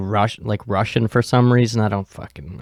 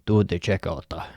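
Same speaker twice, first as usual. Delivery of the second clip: put-on lower, softer stab at a Russian accent; mock Russian accent; 5 semitones lower, 6 dB quieter than usual. Clerk guessing how Russian people say it, low mock Russian accent. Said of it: "Or however Russian people".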